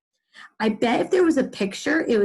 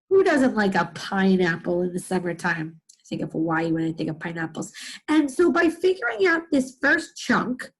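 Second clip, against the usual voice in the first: ordinary talk, nothing marked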